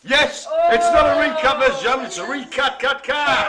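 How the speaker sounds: loud, high-pitched gleeful punchline; triumphant shout. Real, not acted.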